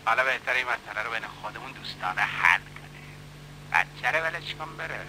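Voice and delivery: menacing low murmur; quiet menace. Acted. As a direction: lean in and threaten him under your breath. Man threatens the boy quietly.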